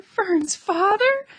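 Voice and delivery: a piglet learning who will kill him; sobbing disbelief. A narrator's reading. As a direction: stretch it out in horror. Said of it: "sobbed Wilbur".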